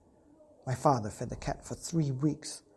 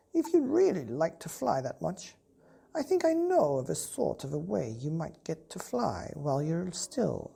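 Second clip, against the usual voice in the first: put-on higher, knowing old cat; 5 semitones higher than usual. Old cat telling the boy about a secret way to fly. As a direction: confide a secret as a worldly cat.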